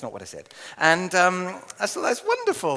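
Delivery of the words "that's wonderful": very high, put-on pleased voice; imitating polite enthusiasm; mock delight. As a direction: gush with pitched-up fake joy. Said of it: "like this".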